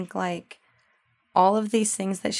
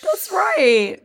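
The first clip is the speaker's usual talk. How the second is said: mocking echo; 11 semitones higher, 7 dB louder than usual. Echoing sarcastic quote.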